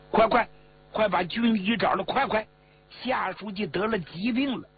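high, pressing orders; urgent alarm. A narrator's reading. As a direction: send them running for the doctor. Urgent orders to fetch doctor.

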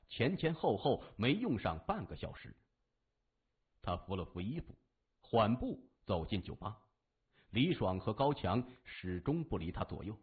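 usual speaking voice

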